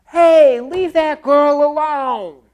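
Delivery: high, loud yell, slow on the second line; neighbor yelling. Retold or imitated. Neighbor yells at the attacker from a window, loud. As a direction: shout down from an open window.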